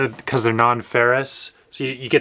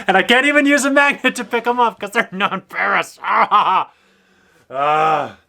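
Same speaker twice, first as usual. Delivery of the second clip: exasperated laugh; 9 semitones higher, 3 dB louder than usual. Real, not acted. Frustrated about dropped screws.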